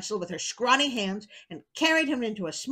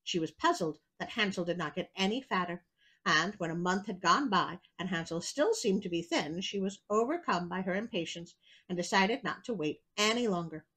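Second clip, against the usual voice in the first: ordinary delivery, nothing marked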